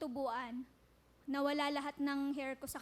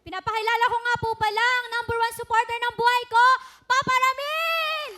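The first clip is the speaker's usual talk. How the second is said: excited announcement; 9 semitones higher, 15 dB louder than usual. Real, not acted. Proudly introducing her father on stage.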